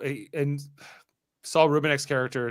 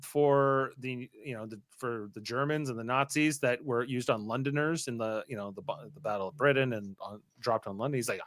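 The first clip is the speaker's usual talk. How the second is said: ordinary delivery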